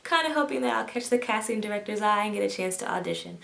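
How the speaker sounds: usual speaking voice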